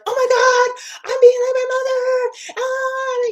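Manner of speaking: high, loud, hammed-up panic; over-the-top horrified cry; panicked realization. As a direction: shriek it like you just caught yourself turning into her.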